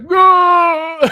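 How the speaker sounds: loud horrified "No!"; high loud shout of dismay. Retold or imitated. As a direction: shriek it in mock horror. Horrified at noticed eyebrow.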